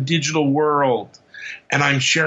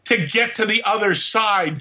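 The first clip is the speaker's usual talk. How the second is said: alien voice; 8 semitones higher than usual. Acted. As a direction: play the alien telling a joke. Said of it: "I laugh with my alien voice".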